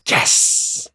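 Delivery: unvoiced hiss of victory; whispered yes. Host celebrating five out of five, whispered yes. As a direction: whisper it triumphantly. Mock triumph at top score.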